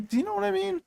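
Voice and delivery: high squeaky "Do you know what I mean?". Pretend. Streamer playing with voices for chat, squeaky high. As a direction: pitch it way up, silly. Playful voice series.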